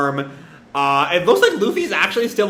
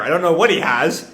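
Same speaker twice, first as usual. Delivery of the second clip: plain reading voice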